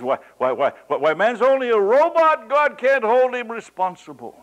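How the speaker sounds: loud, high, protesting whine; quoting the objecting woman in a loud high complaint; mock indignant protest. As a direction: throw up your hands in mock outrage. Preacher voices a woman objecting.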